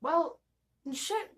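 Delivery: high mock dismay; a sheepish oops; resigned curse. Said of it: "he was like".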